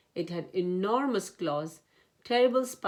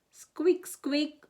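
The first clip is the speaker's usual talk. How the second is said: high squeaking creak; squeaky lantern; 9 semitones higher than usual. A narrator's reading. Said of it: "went the lantern".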